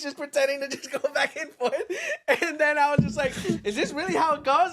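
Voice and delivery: high, laughing through the words; retelling the silly dance lesson, laughing. Real, not acted. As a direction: giggle while you tell it.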